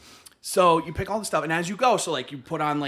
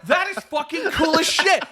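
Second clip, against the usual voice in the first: excited shout; 6 semitones higher than usual. Retold or imitated. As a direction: shout it out, amazed by the kid's saber. Excited kid's lightsaber admiration.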